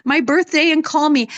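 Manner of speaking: put-on the wounded little girl crying out that her mother doesn't love her; upset inner child. Fifty-year-old daughter upset about a forgotten birthday, high hurt whine. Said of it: "I remember being so upset because I thought".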